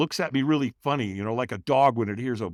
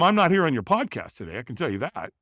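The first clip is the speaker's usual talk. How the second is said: ordinary delivery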